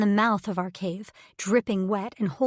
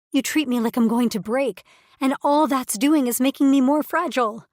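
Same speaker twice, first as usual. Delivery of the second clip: loud, higher frustrated outburst; upset outburst; 4 semitones higher, 7 dB louder than usual. Acted. Woman accuses her mate of coddling her, loud and upset. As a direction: let the frustration spill over.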